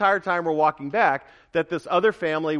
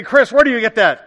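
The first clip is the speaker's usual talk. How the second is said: quick, higher and louder interjection; put-on congregant's question; questioning listener; 6 semitones higher, 9 dB louder than usual. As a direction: pipe up as a challenger from the pews. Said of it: "You say".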